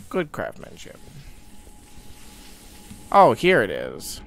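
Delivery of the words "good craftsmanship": Good craftsmanship breathed out unvoiced; hushed aside; whispered. Real, not acted. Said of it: "Good, good craftsmanship".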